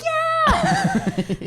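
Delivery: high excited whoop; mock cheer. Server hyping up party table, high mock cheer. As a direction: hype them right back, high. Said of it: "and you're like".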